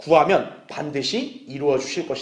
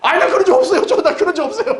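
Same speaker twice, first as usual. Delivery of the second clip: very high, loud, hammed-up protest; mock panicked denial; 13 semitones higher, 6 dB louder than usual. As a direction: play a sinner blurting out excuses, near laughter. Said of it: "그럼 그 죄인이 뭐라 그럴까요".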